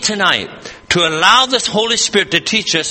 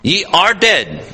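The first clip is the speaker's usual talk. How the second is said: slow, weighty "are" before a falling end; deliberate weight on "are"; stress on ARE; slower than usual. Shown as a demonstration. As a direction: hit "are" firmly and slowly. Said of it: "present tense".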